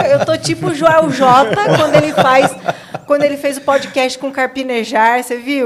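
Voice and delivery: loud, talking through laughter; laughing while talking. Real, not acted. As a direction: keep laughing as you speak. Laughing, describing own reaction.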